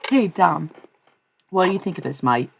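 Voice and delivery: playful put-on Australian greeting; hammed-up Australian accent; mock Australian accent. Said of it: "look Australian".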